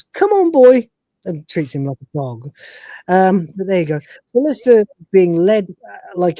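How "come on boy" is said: high, loud call to a dog; mock dog owner. Tribe calls Lister like a pet dog, high and loud. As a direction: bark it like calling a dog.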